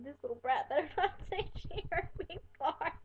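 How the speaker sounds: retold threat dissolving into giggles; laughing threat. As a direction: laugh your way through a mock threat. Amused at own embarrassing thought.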